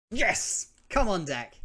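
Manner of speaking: high, loud cheer; excited shout. Real, not acted. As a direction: cheer the draw at full volume. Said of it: "You can, you can tell, uh, how excited I am".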